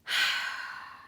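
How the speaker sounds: loud, gusty exhale; long loud breath out; heavy sigh. Real, not acted. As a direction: let out a big exasperated sigh. Loud sigh before retelling her restraint.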